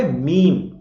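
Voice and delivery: मीम, one long 'meem'; correct pronunciation. Shown as a demonstration. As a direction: answer with a clear मीम. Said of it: "तो क्या बोलोगे".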